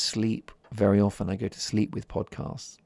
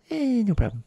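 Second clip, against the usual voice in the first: much higher, louder mock American host; Jay Leno impression; 14 semitones higher, 5 dB louder than usual. Host mimicking Jay Leno's catchphrase, hammed-up. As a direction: ham up a chatty late-night host. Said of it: "Very softly spoken American man".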